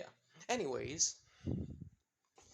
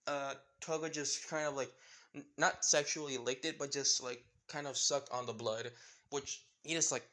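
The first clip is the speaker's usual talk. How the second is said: usual speaking voice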